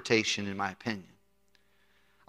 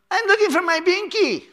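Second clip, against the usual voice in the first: put-on childish whine; 4 dB louder than usual. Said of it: "You're like".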